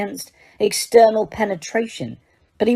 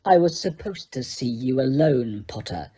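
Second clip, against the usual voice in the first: low sneer, sinking on the name; 4 semitones lower, 4 dB quieter than usual. A narrator's reading. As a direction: sneer it coolly, dropping soft at the end. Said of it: "the familiar sneer curling his mouth".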